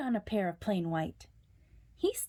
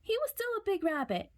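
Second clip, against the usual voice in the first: mock brave; 11 semitones higher, faster than usual. A narrator's reading. Brave self-assurance.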